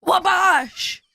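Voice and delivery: put-on shouted catchphrase; hammy shout. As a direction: bark it like a hype man. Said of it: "he looks like he's like".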